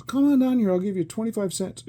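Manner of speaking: high call, loudest at the start; imitated older guy. Older guy offering the kid money, high call-out. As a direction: holler an offer, imitating him. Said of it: "He goes".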